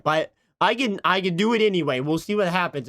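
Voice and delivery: normal talking voice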